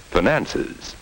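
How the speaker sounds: normal talking voice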